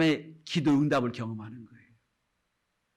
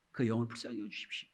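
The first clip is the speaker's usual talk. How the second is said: pastor's prayer; 4 semitones lower, 9 dB quieter, faster than usual. Retold or imitated. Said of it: "이 기도를 해요".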